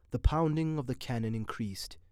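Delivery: usual speaking voice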